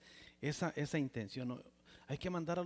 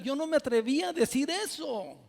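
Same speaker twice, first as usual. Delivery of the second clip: loud, high-pitched preaching climax; preaching at full force; 8 semitones higher, 7 dB louder than usual. Acted. Preacher to the congregation about his desperation, loud fervent preaching. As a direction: preach it out, building to a loud peak.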